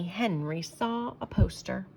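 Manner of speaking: ordinary delivery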